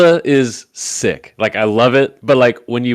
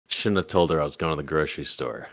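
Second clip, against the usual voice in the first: quieter, muttered regret under the breath; inner grumble; 5 semitones lower, 6 dB quieter than usual. Retold or imitated. Husband regretting telling his wife, quiet muttering to himself. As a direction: say it to yourself, softer. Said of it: "in my head, I'm like".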